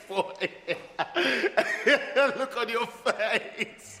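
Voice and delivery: laughing through the words, slow; mocking laughter. Patient laughing at the doctor's shocked face, words through laughter. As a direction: gloat while cracking up.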